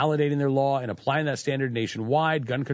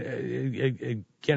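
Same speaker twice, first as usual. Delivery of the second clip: usual speaking voice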